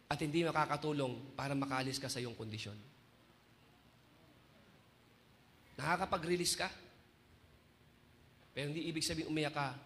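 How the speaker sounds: low, soft, measured sermon; solemn preaching. Acted. Preacher tells the crying believer what God says, solemn hushed preaching. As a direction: drop down low and let each phrase settle.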